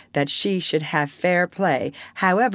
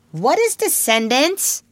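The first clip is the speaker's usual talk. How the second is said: put-on high, slow childish question; puzzled child; 12 semitones higher, 4 dB louder, slower than usual. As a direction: ask like a small baffled boy.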